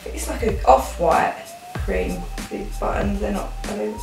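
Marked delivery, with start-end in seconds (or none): none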